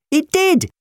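put-on very high, loud boy's protest; insistent. A narrator's reading. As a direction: insist on it hotly. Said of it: "Jim insisted".

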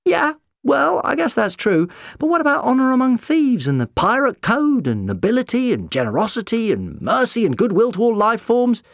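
a doctor pleading his high moral case; indignant doctor. Acted.

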